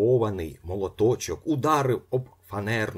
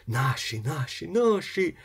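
building higher and slower; rising excitement; 9 semitones higher than usual. A narrator's reading. Ippolit's whole body shouting, rising in pitch. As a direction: let it swell with joy. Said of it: "кричав увесь його організм".

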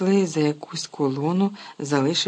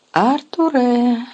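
long loud call, much higher; 9 semitones higher, 8 dB louder, much slower than usual. Acted. Mother calling her husband across the platform, loud drawn-out call. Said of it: "гукнула місіс Візлі".

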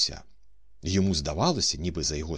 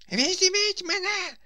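high-pitched desperate yell, drawn out; репетування; 4 dB louder, slower than usual. A narrator's reading. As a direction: scream it far above your voice, stretching the words. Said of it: "репетував, що було сили Паніковський". (відчайдушне репетування високим голосом)

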